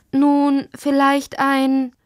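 hoch und gedehnt, nachdenklich zögernd; zögere, als suchtest du nach dem Wort. A narrator's reading. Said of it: "Lottis Vater zögerte". (zögerlich, gedehnt)

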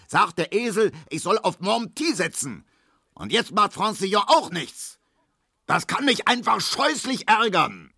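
high-pitched choleric rant; furious, strained tirade; bellowing. A narrator's reading. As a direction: rage on without letting up. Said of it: "brüllte Herr Markowski".

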